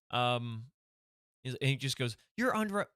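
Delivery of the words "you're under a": high, clipped police officer arresting; cop character voice. Acted. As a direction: play the officer, pitched way up.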